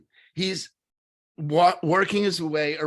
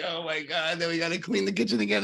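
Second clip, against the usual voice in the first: mock frazzled parent; 3 semitones higher, faster than usual.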